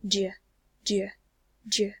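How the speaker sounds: "je" as one short syllable, do and you merged; merged words. Shown as a demonstration. Teacher showing students how words merge, merged "je". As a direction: squash "do you" into a single "je". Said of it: "merge these two words together and say".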